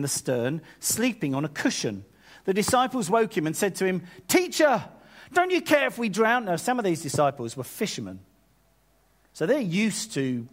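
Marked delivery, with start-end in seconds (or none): 4.3-6.5 high, urgent cry for help; acted alarm, pitched right up; panicked. Disciples waking Jesus in the storm, panicked.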